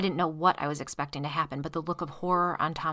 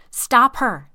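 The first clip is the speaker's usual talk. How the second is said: loud, higher yell; man chasing his wife, yelling; 7 semitones higher, 14 dB louder than usual. Acted. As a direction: bark the order. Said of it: "Tom yelled".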